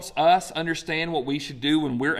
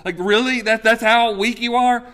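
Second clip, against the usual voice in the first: incredulous; 7 semitones higher, 7 dB louder than usual. Pretend. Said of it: "I'd be like".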